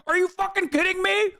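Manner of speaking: high, incredulous outrage; outraged. Real, not acted. Streamer reacting to the video, outraged disbelief. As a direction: disbelief boiling over.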